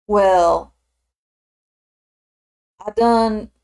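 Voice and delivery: put-on Southern drawl, easing into it; Southern accent. Shown as a demonstration. Streamer trying out a Southern accent on request, Southern drawl. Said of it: "I can do a Southern accent".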